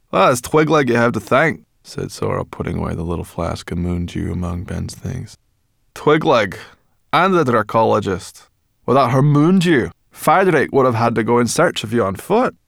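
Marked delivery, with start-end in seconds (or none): 0.1-1.6 high, loud, brisk character voice; brisk Sorrel. A narrator's reading. Sorrel tells Ben whom to thank, brisk and high. 5.9-12.5 high, loud, brisk character voice; brisk Sorrel. A narrator's reading. Sorrel tells Ben whom to thank, brisk and high.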